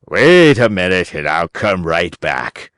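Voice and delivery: an old man pretending to help; old man's voice. A narrator's reading. Old man answers from window.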